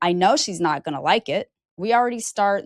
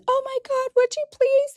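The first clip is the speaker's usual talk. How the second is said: very high, delighted squeal; squealing client. Retold or imitated. Hairdresser imitating her client's relieved squeal, very high. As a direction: gush like a rescued client.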